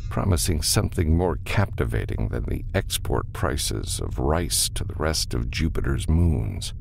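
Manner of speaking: plain reading voice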